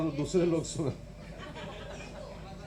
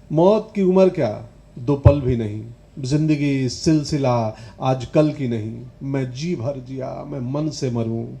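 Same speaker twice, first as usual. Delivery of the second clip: emphatic, measured verse delivery; declaimed poem with solemn weight; poetry recitation; 3 semitones lower, 8 dB louder than usual. Acted. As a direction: hit the openings firmly, settle at line ends.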